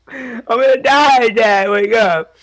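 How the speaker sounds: high, loud, drawn-out pleading; scared kid begging his dad; panicked whine. Retold or imitated.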